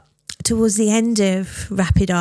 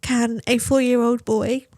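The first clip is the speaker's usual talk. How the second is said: breaking with grief; 4 semitones higher than usual. Real, not acted. Recalling son's wish, grief.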